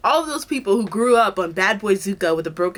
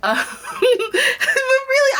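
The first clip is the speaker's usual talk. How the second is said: high giggly words through a laugh; much slower than usual. Real, not acted. Laughing at her own joke to the viewers, giggly.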